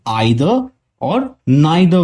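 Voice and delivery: आइदर और नाइदर with a long "eye" vowel; alternative pronunciation. Shown as a demonstration. Teacher showing the other accepted way, आइदर और नाइदर with "eye" sound. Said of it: "इन दो words को लोग".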